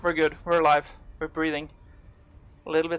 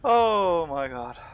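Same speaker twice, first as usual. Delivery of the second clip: slow exhale of relief; 3 dB louder, much slower than usual. Real, not acted. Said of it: "I'm literally sweating right now".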